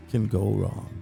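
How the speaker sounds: ordinary delivery, nothing marked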